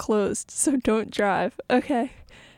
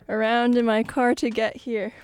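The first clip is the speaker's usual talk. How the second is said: usual speaking voice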